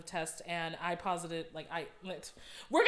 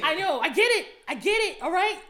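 louder, higher, exasperated "I get it"; mock exasperated; 9 semitones higher, 8 dB louder than usual. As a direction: brush it off loudly and impatiently.

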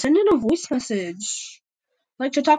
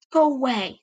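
hissing defiance; 5 semitones higher, 6 dB louder than usual. A narrator's reading.

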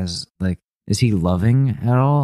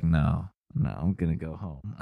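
quiet reluctant Melania; 6 semitones lower, 9 dB quieter than usual. Acted. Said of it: "she's just like".

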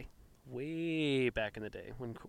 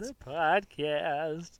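very slow, drawn-out Walken echo; lightly voiced Christopher Walken; Christopher Walken impression; 4 semitones higher, 6 dB louder, much slower than usual. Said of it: "Christopher Walken edition".

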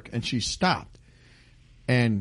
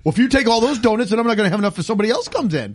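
mock huffy shopkeeper; 7 semitones higher, 4 dB louder, faster than usual. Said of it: "this lady is like".

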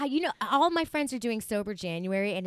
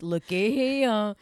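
very slow drawled Southern looky here; hammed-up slow Southern drawl; 6 dB louder, much slower than usual. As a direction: stretch it out like a country Virginian. Mock Southern catchphrase.

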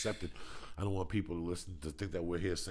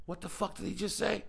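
stunned disbelief; 12 semitones higher than usual. Retold or imitated. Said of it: "I kept thinking".

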